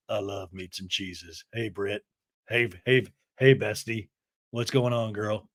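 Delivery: low put-on man's voice; Jared. Man pops out to greet his bestie, in a low put-on voice. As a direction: drop the pitch well down for his greetings. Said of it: "Jared pops out".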